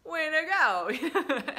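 very slow, high, loud cheer; over-the-top praise. Retold or imitated. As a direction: cheer the kid on like a fan. Praising a child's dream job.

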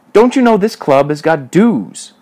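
loud, high-pitched challenge; menacing demand. A narrator's reading. Major tells Alfred the club has dues, menacing demand.

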